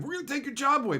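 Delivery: high-pitched mocking scare voice; mock threat. Bosses trying to make workers afraid, mocking. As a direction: mimic a threat in a silly high voice.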